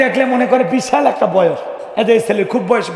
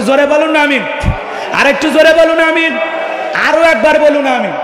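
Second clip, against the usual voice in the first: high, raised rallying call; rousing call; 6 semitones higher than usual. Real, not acted. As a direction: call out to a crowd for a response. Rousing the crowd to say amen.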